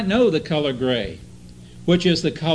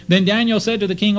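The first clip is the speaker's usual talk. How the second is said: normal talking voice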